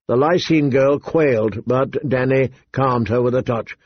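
ordinary delivery, nothing marked

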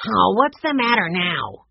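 fed up with the fuss; annoyed tone. A narrator's reading. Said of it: "in an annoyed tone".

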